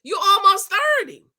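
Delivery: put-on high, loud sassy "girl, you almost thirty"; sassy retort. Roasting a reality show contestant, sassy and loud. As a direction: scoff at her like a friend. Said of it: "and I'm like".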